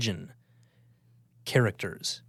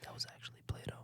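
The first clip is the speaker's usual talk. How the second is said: hushed whispered correction; ASMR whisper; 17 dB quieter, faster than usual. Pretend. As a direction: whisper it close. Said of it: "doing A S M R".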